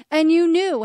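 loud, high accusation; accusing. Retold or imitated. Telling the RCIA leader she knew all along, in a loud accusation. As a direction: hit it hard, you knew.

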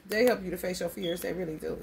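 normal talking voice

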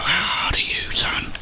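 usual speaking voice

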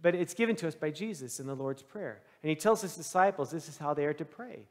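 ordinary talk, nothing marked

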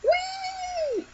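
high gleeful squeal; excited whee. Real, not acted. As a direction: squeal like on a ride. Diving in a game.

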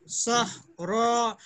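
सहरा drawn out syllable by syllable; slow demo. Shown as a demonstration. Teacher slowing सहरा down so students catch it. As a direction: stretch the word for the class. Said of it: "इसकी जमा भी बनेगी".

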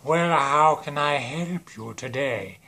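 slow, drawn-out put-on villain voice; stretched-out cartoon villain shop clerk; Space Voldemort. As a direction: greet a customer as a creepy drawling villain. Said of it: "who should greet them but Space Voldemort".

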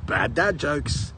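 ordinary delivery

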